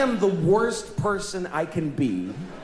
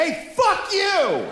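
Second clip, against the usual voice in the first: high, slow yell out the car window; road rage yell; 12 semitones higher, much slower than usual. Retold or imitated. Driver yelling at a pickup truck out the window, high slow yell. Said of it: "I yelled out my window".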